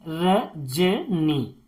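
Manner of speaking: "Rajni" very slowly in three separate pieces, र, ज, नी; "Rajni" drawn out with a pause after each syllable. Shown as a demonstration. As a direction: break "Rajni" into र... ज... नी for learners. Teacher splits word into syllables.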